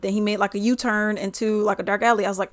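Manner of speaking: ordinary delivery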